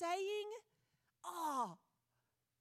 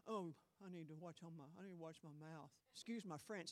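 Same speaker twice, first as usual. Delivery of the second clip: much lower and much softer, a mumbled half-hearted sorry; sheepish mock apology; 11 semitones lower, 6 dB quieter than usual. Preacher mimicking a churchgoer excusing their swearing, low sheepish mutter. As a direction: duck your head and mutter the excuse like a caught kid. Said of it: "And you're going".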